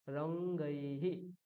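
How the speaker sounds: রঙ্গৈহি drawn out slowly, each vowel sounded; slow, clear. Shown as a demonstration. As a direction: spell out রঙ্গৈহি syllable by syllable. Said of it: "স্পষ্ট করে বলতে হবে".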